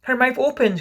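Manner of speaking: high, loud report; lifted, wondering exclamation; excited cry. A narrator's reading. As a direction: cry out the news. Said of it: "cried a third".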